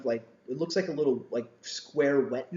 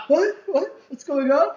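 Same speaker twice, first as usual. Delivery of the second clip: high, loud, clueless parent voice; mock oblivious parent; 13 semitones higher, 8 dB louder than usual. Parents pretending not to notice the smell, mock clueless. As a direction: play dumb like the parent who smells nothing.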